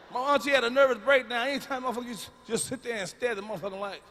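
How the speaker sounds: ordinary talk, nothing marked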